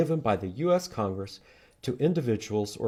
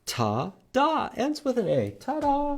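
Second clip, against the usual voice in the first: playful ta-da; 14 semitones higher, slower than usual. Acted. Playful magic flourish.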